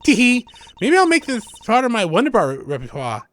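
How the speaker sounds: put-on high, bright young magician girl; voicing the girl sidekick, perky and high; cheery girl voice. Voices Trucy in game dialogue.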